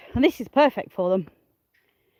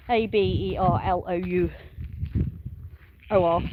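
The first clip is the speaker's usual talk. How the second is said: usual speaking voice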